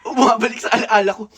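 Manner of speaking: laughing while remembering. Real, not acted. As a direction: laugh through the exclamation. Laughing at memory.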